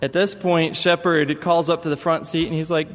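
ordinary talk

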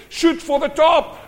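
high, loud answering battle cry; acted high-pitched military shout; shouted order. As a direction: yell the command across a battlefield. Other army shouting its order.

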